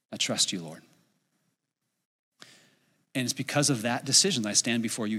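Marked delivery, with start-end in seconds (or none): 0.1-0.8 low, hushed prayer of trust; soft, low, yielding prayer; quiet surrender. Retold or imitated. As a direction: surrender softly, voice dropped low. Retold surrender to God in prayer.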